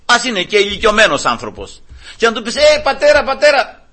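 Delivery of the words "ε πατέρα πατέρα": high call to get attention. Acted. Calling a passing stranger father, calling out. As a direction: hail someone passing by. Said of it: "να τον φωνάξεις Πατέρα".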